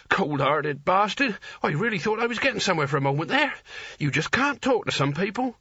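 breathless wheezing rant. A narrator's reading. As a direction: wheeze it out, indignant. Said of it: "he wheezed".

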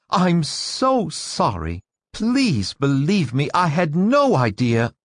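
high, drawn-out, flustered pleading; mortified woman, pitched high and imploring; gasping apology. A narrator's reading. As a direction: apologise as if just finding your voice. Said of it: "Missus Parker gasped, finding her voice".

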